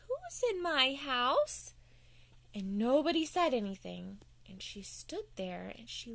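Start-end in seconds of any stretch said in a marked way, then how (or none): 0.1-1.7 suspicious fairy. Acted. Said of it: "and said".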